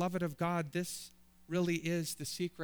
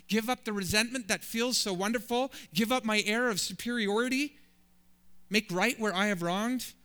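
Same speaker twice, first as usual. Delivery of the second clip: higher and louder, each "give up" hammered; impassioned protest; 5 semitones higher, 5 dB louder than usual. Acted. Believer protesting the Sermon on the Mount to the Lord, loud and incredulous. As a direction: rattle off each demand in disbelief. Mock protest list to Jesus.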